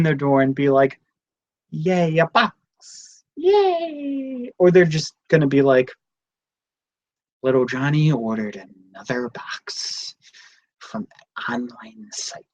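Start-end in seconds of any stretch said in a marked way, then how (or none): 1.7-4.5 mock delight. Imagined neighbour opening a box. 7.4-12.5 whispered mock parent complaint; a parent's whispered grumble about Johnny's box. Imagined parent grumbling about orders.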